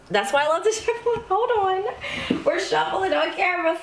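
high, laughing surprise; amused laughing. Real, not acted. Reader reacting to a mishap while shuffling on camera, amused laughing.

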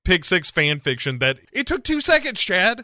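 high, loud exasperated rant. Real, not acted. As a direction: rant it in disbelief.

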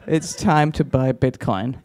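normal talking voice

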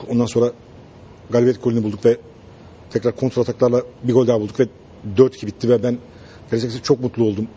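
deadpan coach. Retold or imitated. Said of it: "Aykut Hoca ... mikrofonu uzatıyorlar".

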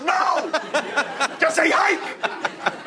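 very high, drawn-out furious mock yell; exasperated yell. Director loses patience with the extra, exasperated yell. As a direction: holler it slowly, voice way up.

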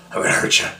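raspy menacing growl; Crack Fox alter ego. Retold or imitated. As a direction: threaten like a crazed beast. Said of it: "then he goes".

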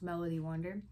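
ordinary delivery, nothing marked

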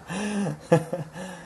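soft laugh at a cheeky question; high, quiet chuckle; amused laugh. Real, not acted. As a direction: laugh it off lightly.

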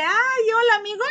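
high, loud, sugary hello, acted out; mock sweet greeting. She opens the door to greet her friend, sugary high hello. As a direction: greet the friend far too eagerly.

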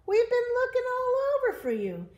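very high, loud, rapid animal voice; squeaky eager Goat; excited Goat. Acted. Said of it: "said Goat".

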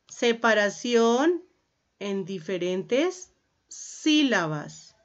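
slow dictation with pauses between chunks. Real, not acted. Teacher dictates a definition to the class, slow dictation.

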